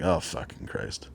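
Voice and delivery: whispered curse, barely voiced; under-breath curse. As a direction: breathe it out in dread. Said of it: "I'm like".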